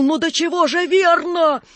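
high-pitched excited cry; delighted exclamation. A narrator's reading. Clerk applauding the show, high delighted cry. Delighted spectator claps and stamps.

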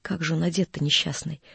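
low, soft, pitying question; softened voice. A narrator's reading. Wife asks how he was dressed, tenderly. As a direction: let the voice soften into concern. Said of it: "и голос её смягчился".

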